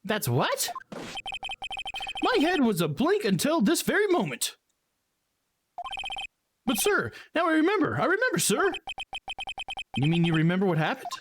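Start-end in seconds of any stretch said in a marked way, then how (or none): 2.2-8.7 high, eager rookie officer; high excitable cop voice. Acted. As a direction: play him as a jumpy, overeager young cop.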